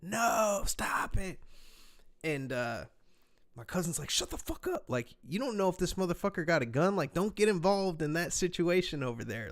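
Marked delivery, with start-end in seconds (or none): 0.0-1.4 high, loud cry of alarm; retold scream. Cousin's wife begging a man not to throw a chair, high alarmed yell. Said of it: "she starts screaming". 4.1-9.5 high, sharp, louder rebuke that settles down; mock angry scolding. Cousin telling his wife to stay out of the fight, heated scold.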